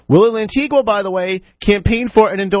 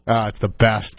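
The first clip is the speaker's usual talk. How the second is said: ordinary talk, nothing marked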